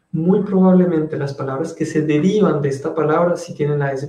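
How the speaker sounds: ordinary delivery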